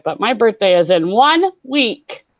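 ordinary delivery

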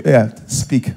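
low, curt, eye-rolling brush-off; dismissive reply. Acted. Partner answering a request to talk, dismissive. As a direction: answer as if you can't be bothered. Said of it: "expert in rolling eye".

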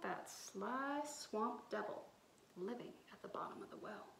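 fast hushed storyteller whisper; storyteller whispering about the devil; spooky whisper. A narrator's reading. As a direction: whisper it like a scary secret.

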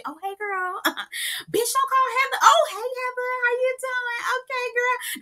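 high, squeaky mock-sweet greeting, shrill fake cheer. Mocking girls greeting Heather.

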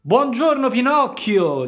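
a sly fox greeting him politely; the fox's polite greeting. A narrator's reading. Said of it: "salutandolo garbatamente". (volpe che saluta garbatamente, voce molto più alta e lenta)

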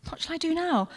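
ordinary delivery

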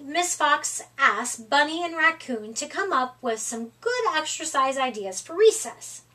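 plain reading voice

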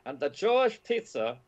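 put-on lightly Austrian-accented, pitched high; a hint of Wolfgang Puck's Austrian accent. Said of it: "I'm doing his impeccable Austrian accent".

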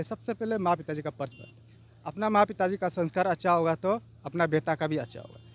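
ordinary talk

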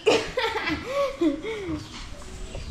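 giggling high and bright; high laugh. Real, not acted. Vlogger laughs over her sister's cake with a high giggle.

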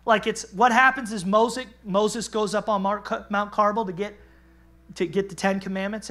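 normal talking voice

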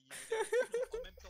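en riant. Real, not acted. Laughing punchline.

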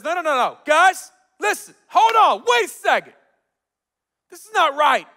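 loud, high calling to a crowd; urgent intervention. Retold or imitated. Gideon's dad stepping up before the crowd, urgent shout. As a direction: shout them down to make them wait.

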